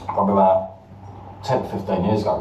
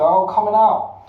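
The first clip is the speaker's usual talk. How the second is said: much higher and bright with glee; eager; 9 semitones higher, slower than usual. Retold or imitated. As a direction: chirp it like a kid allowed out. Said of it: "Or you'd go".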